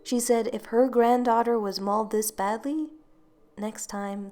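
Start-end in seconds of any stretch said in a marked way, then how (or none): none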